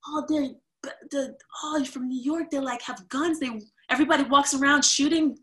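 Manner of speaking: high, loud hammed-up panic; mock alarmed locals. South Africans warning a New Yorker, mock panicked. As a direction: act out breathless horror. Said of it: "they were like".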